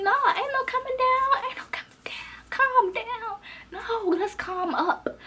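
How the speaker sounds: very high, worked-up refusal; upset outburst. Retold or imitated. Student refuses to settle in the quarrel, squealing upset. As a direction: re-enact losing it, voice shooting way up. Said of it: "I was just upset".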